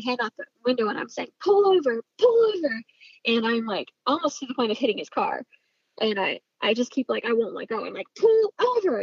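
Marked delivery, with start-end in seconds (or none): 1.4-2.8 high-pitched insistent call; re-enacted high, urgent yell; retold urgent shout. Shouting from car window while chasing. 8.2-9.0 high-pitched insistent call; re-enacted high, urgent yell; retold urgent shout. Shouting again at fleeing driver.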